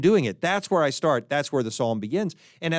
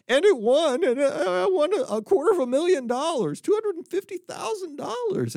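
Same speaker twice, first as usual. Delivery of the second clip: weeping man; 13 semitones higher than usual. Acted. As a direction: wail out the lottery story. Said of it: "crying man in joke".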